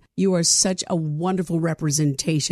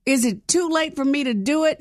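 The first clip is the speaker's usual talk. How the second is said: higher, playful mock-hopeful ask; joking question; 7 semitones higher than usual. Real, not acted. Host joking with the pageant winner, playful. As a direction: pretend to be hopeful, teasing. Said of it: "No, I'm just joking".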